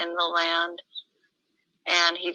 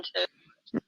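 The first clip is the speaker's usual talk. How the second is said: usual speaking voice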